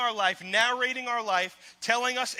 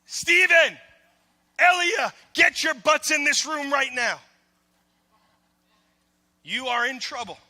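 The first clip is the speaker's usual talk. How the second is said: put-on loud, high shout at the kids; father shouting for his children; angry father yell; 4 semitones higher, 8 dB louder than usual. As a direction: yell for the kids to come now. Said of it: "And so I'll say".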